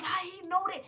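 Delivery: high, loud shocked question; stunned disbelief. Retold or imitated. Vlogger retelling hearing her words in a movie, shocked high cry.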